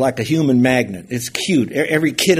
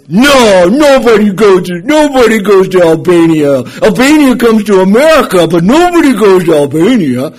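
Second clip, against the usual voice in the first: loud, higher imitation of an Albanian accent; Jimmy's Albanian brogue; 5 semitones higher, 10 dB louder than usual. Albanian neighbor insisting to the wife, loud Albanian brogue. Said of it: "in his own little foreign language brogue".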